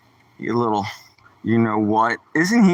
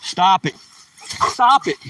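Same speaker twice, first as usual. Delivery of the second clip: rising scold; 4 semitones higher than usual. Real, not acted. Dog misbehaving.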